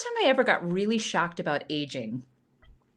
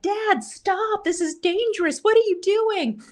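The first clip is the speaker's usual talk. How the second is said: high-pitched mock yell, only a bit louder; retold alarmed scream; 11 semitones higher, 3 dB louder than usual. Daughter screaming at dad on the pogo stick, high alarmed mock yell. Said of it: "we're screaming at him like".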